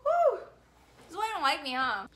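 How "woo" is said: high loud whoop; manic whoop. Real, not acted. Vlogger calling herself psycho, a very high loud whoop. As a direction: let out a crazed whoop.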